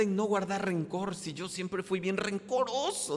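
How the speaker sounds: loud, high, pleading questions to God; pleading prayer. Acted. Preacher voicing a believer praying to Jesus, loud pleading. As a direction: beg the Lord for an answer.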